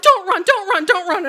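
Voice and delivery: high, loud, frantic repeating; panicked self-warning. Retold or imitated. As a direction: urge yourself not to flee in terror. Gorilla rushes at her.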